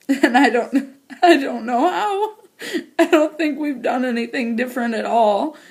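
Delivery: high, choked laugh-crying; crying and laughing at once from relief; laughing through tears. Real, not acted. Telling her viewers the good news, laughing through tears. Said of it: "I know I'm an ugly crier".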